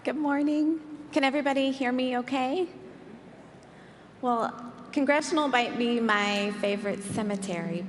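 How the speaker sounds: projecting to the room; in front of an audience. Real, not acted. Speaker opens talk to congregation.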